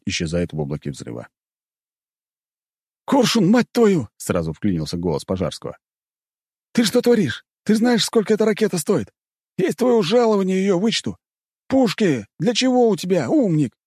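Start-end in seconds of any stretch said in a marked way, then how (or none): 3.1-4.1 furious yell, high and slow; angry shout. Acted. Furious commander yells at pilot. 6.7-13.8 high furious tirade; angry scolding. Acted. Commander scolds pilot over rocket.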